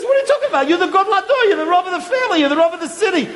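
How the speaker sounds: high, chuckling deferral; amused protesting rabbi; laughing modest rabbi. Retold or imitated. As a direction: humbly push the honor back. Rav Ruderman defers back, laughing.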